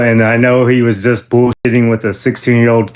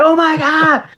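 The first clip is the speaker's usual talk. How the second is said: much higher, excited; starstruck; slower than usual. Retold or imitated. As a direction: gasp at spotting a celebrity. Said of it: "was like".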